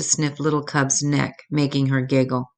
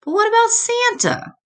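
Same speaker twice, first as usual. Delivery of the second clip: put-on high child voice. Cub asks mama about Santa.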